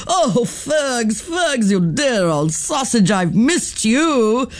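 high, slow, fond reunion voice; a dear old friend found again; Auntie Twinkle overjoyed. Acted. Twinkle hugging her old school friend, high and fond.

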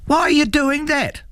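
very high, demanding question. A narrator's reading. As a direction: demand an answer, pitched right up. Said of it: "Algy demanded to know".

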